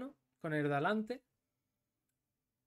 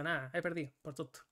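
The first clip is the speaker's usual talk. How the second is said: ordinary talk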